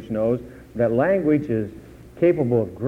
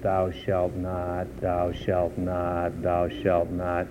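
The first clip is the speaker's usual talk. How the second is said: slow, low and soft, each word weighed; solemn intoning; 7 semitones lower, 7 dB quieter, much slower than usual. Acted. Preacher intoning the commandments, slow and grave.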